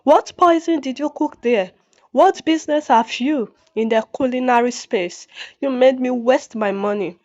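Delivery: usual speaking voice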